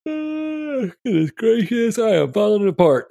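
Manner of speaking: high, strained mock Rock voice; squeaky send-up of The Rock. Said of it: "that's what The Rock said".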